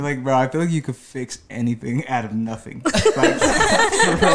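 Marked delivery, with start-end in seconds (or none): 2.8-4.4 laughing. Real, not acted. Laughing at the compliment.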